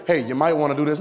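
mock noncommittal advisor; tentative suggestion. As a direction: offer it as a take-it-or-leave-it idea. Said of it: "the Holy Spirit don't talk like that".

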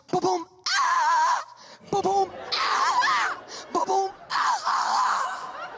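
spoken ba-boom, then a high shriek; hammed-up squealing cries after each bump; bump and scream. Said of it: "I hear this".

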